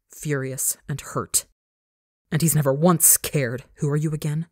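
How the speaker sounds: ordinary delivery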